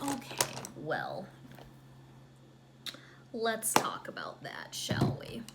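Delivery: hushed patronising. Retold or imitated. Dad and Bruce explaining.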